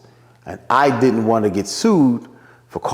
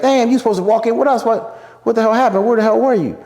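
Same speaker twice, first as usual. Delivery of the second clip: high, lightly voiced friend complaining; friend scolding; 13 semitones higher than usual. Friends at the club asking why he was late, high mock scolding. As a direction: pitch it up as a pal asking where he was.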